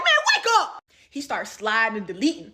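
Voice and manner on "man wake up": much higher, loud shout; exasperated yell. Retold or imitated. Shouting at the lying boyfriend, exasperated. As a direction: yell at him to snap out of it. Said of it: "And I'm like".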